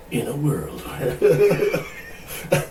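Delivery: very slow laughing trailer narrator; movie trailer voice. Shown as a demonstration. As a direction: drag it out like a trailer.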